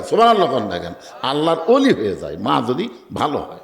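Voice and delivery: ordinary talk, nothing marked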